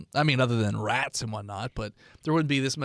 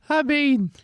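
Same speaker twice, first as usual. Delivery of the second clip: homeless man voice; 13 semitones higher than usual. Acted. Homeless NPC answers.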